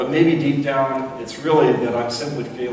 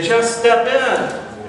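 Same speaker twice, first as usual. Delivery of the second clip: high, slow coaxing call; rescuer's call; 9 semitones higher, 4 dB louder, much slower than usual. Retold or imitated. Boat crew urging the man at the window, slow high call. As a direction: draw it out to lure him into the boat. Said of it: "and they say".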